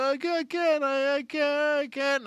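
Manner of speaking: very high mock wail; acted distraught maid; upset whimpering. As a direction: stammer it out in a pleading whine. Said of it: "she gets even more upset".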